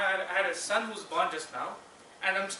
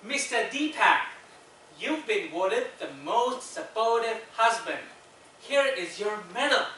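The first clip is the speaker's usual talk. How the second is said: put-on southern accent; 3 semitones higher, 4 dB louder than usual. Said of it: "That was my southern accent".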